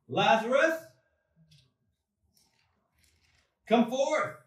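Lazarus called out loud, voice ringing toward the tomb; loud cry. A narrator's reading. Jesus calling Lazarus from the tomb with a loud cry. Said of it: "he cried out with a loud voice".

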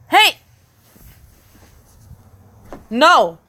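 short, loud call to stop; scolding yell. Real, not acted. Babysitter stopping a toddler getting out, scolding yell. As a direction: holler a sharp warning. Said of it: "I'm screaming at this kid".

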